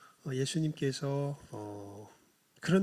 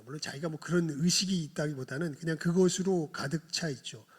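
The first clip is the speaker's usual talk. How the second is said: normal talking voice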